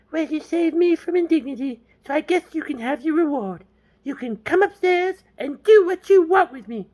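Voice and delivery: very high falsetto woman; mock girl voice. Girl offers James his reward, mock falsetto. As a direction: imitate a woman in exaggerated falsetto.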